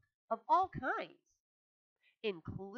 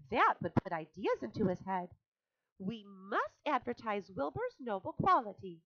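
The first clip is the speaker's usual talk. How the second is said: high, louder spider voice pleading her case; Charlotte, earnest and alarmed; 6 semitones higher, 3 dB louder than usual. A narrator's reading. Charlotte to Templeton about Wilbur, high and insistent. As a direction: insist with rising urgency.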